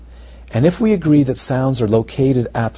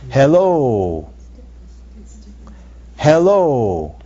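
thespian voice; much slower than usual. Shown as a demonstration. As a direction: project like a stage actor. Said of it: "I have a thespian voice".

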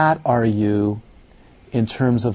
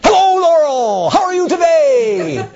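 much higher, loud stagey hello with a laugh; thespian greeting; 4 dB louder than usual. Retold or imitated. Thespian friend greeting Laurel, high and theatrical. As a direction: sing out the greeting like a stage actor. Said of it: "two thespian actors trained in Shakespearean".

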